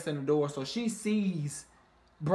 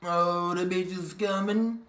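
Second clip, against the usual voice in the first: messy shade; 3 dB louder than usual. Retold or imitated. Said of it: "talking about".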